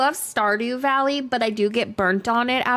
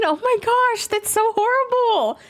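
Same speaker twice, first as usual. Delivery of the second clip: shocked; 11 semitones higher than usual. Real, not acted. Streamer reacting to a grim in-game quote, shocked. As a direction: gasp it out in horror.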